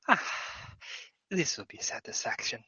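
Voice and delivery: breathy satisfied whisper; whispered kobold. Acted. Kobold Morzar finding treasure in the safe, whispering. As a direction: savour the find under your breath.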